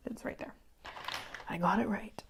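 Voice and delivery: little whisper of satisfaction to herself; whispered aside. Real, not acted. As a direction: breathe it, pleased, to yourself. Quiet self-check while sewing.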